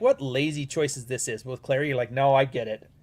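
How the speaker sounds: ordinary delivery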